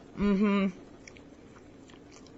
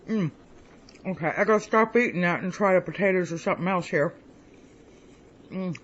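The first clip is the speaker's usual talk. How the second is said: normal talking voice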